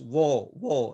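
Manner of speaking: "Wo" held long, slowly; slow, lengthened "Wo, wo"; drawn-out syllable. Shown as a demonstration. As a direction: stretch "wo" out to show its length. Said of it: "How many syllables?".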